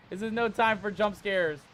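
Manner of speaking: normal talking voice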